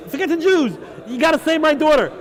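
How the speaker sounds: a frantic father cutting him off; desperate demand. Acted. Caesar desperate for his daughter.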